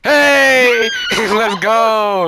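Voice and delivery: loud, high holler with a laugh; rowdy shouted cheer; excited yell. Pretend. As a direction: whoop it out. Calling out while playing.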